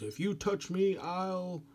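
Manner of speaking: a small guy acting tough; little Serbia's threat. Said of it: "Serbia says".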